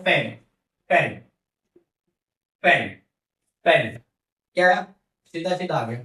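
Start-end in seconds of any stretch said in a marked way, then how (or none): 0.0-4.0 "पेन" with a short, simple "e"; "पेन" as a teacher's short-vowel demo. Shown as a demonstration. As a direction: say "पेन" briefly, no glide. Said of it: "छोटा वाला sound आता है".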